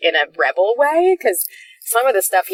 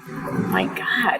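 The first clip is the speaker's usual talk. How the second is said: exasperated whisper; 7 semitones higher, 5 dB quieter, much slower than usual. Retold or imitated. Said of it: "and I go".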